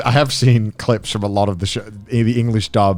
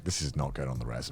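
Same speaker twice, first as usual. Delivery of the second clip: much softer, lower inner thought; muttered aside; 9 semitones lower, 16 dB quieter than usual. Retold or imitated. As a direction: mutter it to yourself. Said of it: "I'm like".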